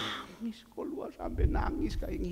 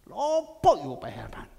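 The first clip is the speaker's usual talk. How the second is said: louder, exasperated repeat of the question; annoyed louder retort; 3 semitones lower, 8 dB louder than usual. Retold or imitated. Sick man snapping back at Pak Herman, louder and annoyed. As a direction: snap the question back, fed up. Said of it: "kok melok ae terus".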